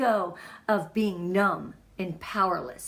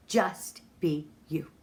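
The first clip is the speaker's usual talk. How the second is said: ordinary talk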